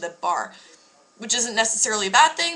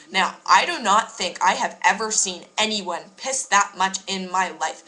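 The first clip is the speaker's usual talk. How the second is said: ordinary delivery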